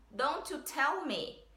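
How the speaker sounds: slow, careful English demo phrase; slow clear demo. Shown as a demonstration. Teacher reads the song line slowly to students. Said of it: "a primeira palavra que eu separei é tell".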